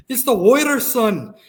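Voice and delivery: loud, high Scottish grandfather; Scottish accent. Retold or imitated. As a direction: exclaim it like an excited Scottish granddad. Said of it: "very deep Scottish accent".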